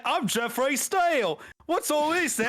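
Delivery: high laughing British bobby shout; British copper Jeff. Retold or imitated. Retelling Jeff's entrance, laughing British constable shout. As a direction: ham up the Cockney copper. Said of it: "That's exactly how he talks".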